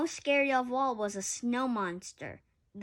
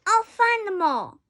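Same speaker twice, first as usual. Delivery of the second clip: loud, high heroic vow; 9 semitones higher, 8 dB louder than usual. Acted. Rudolph vowing to find his family, loud and high. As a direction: declare it loud and determined. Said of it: "Rudolph vowed".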